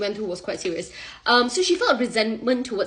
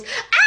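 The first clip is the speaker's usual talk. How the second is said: high, loud mock shriek; imitated scream; 5 dB louder than usual. Wife learning of another loan, mock screaming. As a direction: let out a hammed-up scream. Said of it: "she starts screaming".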